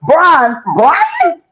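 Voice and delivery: high, confused repeat of the name; baffled repeat. Retold or imitated. The judge asking about Brian, high and baffled. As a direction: say it like it makes no sense. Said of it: "The judge said".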